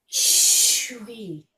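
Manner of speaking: Shui breathed out without voice; whispered exhale on Shui. Shown as a demonstration. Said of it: "breathe it out like a whisper".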